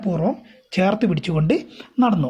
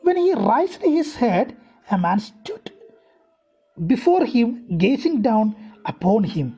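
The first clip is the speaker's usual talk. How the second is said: a careful read-aloud for students; slow story reading; 2 semitones higher, much slower than usual. A narrator's reading. Teacher reading the English text aloud to her class, slow and deliberate. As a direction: read each English phrase slowly and deliberately.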